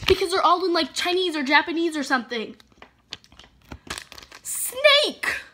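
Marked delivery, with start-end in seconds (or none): 4.4-5.4 very high squeaked snake; squealed disgust. Real, not acted. Vlogger rereading the snake label, high squeak of disgust. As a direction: squeak it out in horror.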